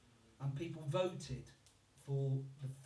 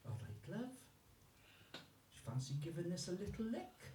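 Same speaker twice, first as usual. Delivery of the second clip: Welsh accent; 7 dB quieter, faster than usual. Shown as a demonstration. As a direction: purr it softly in a Welsh accent. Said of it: "That was at number one".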